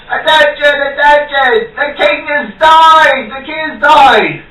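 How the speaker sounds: high, loud, slow town crier call; herald's cry. Acted. Herald crying the king's death to the crowd, high and loud. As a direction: proclaim the news to the whole square.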